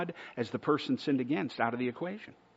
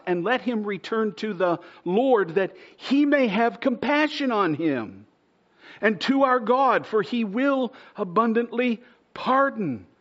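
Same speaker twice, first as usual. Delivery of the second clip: builds louder and higher toward the end; scripture read with rising fervour; 7 semitones higher, 4 dB louder than usual. Acted. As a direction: proclaim the verse, climbing to a cry. Reading scripture rising to full force.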